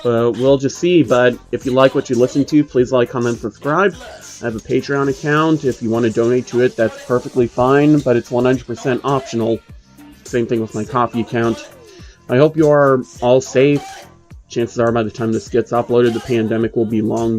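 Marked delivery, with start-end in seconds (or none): none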